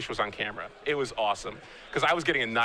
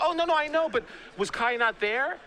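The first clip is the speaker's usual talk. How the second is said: higher, eager mom voice; imitating his mom; 11 semitones higher than usual. Mom still asking her son about Kai, higher voice. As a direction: mom brushing past the news, lightly voiced.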